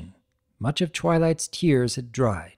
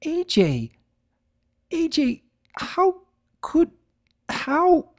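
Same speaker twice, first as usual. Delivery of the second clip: much higher, broken whimpering; slower than usual. Acted. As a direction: stammer out in fear. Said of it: "her voice came out in a foolish whimper".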